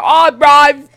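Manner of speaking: loud, high-pitched mock Australian; exuberant, badly faked Australian accent. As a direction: shout it like a caricature Aussie. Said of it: "I can't do an Australian accent".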